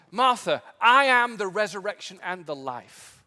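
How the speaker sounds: Jesus declaring himself to Martha; commanding declaration. Acted. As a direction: speak with full divine authority. Jesus answering Martha square on.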